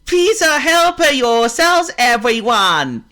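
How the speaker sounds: very high, loud, slow host; panda chef voice. Acted. Ping the panda inviting everyone to eat, high and loud. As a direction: announce the feast like a proud panda chef.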